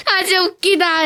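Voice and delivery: high, breathless laughter; laughing hard. Real, not acted. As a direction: crack up helplessly. Laughing fit after win.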